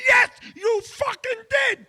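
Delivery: high, slow, drawn-out yell; furious retort. Retold or imitated. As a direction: hammer every word back in outrage. Acted quarrel, patient yells back.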